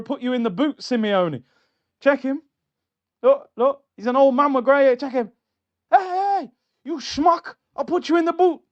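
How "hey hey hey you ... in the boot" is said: high, loud hammed-up gangster; mock mafia threat. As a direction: imitate a riled mafia boss threatening someone. Voicing Berta as mafia boss.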